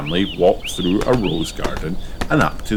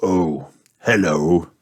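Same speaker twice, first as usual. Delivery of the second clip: Mister Wolf voice; slower than usual. A narrator's reading. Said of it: "said Mister Wolf".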